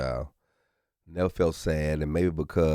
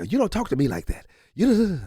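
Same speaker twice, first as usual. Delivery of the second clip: higher, fast scolding yell; mock mom scolding; 14 semitones higher, 4 dB louder, faster than usual. Wife scolding daughter, fast mock yell. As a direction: scold the kid like an angry mom. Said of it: "yelling at Charlie".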